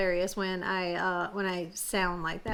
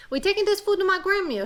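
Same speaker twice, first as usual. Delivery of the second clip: put-on much higher, louder Cajun girl; little girl voice; 10 semitones higher, 4 dB louder than usual. Petite Rouge telling the gator off, high Cajun girl voice.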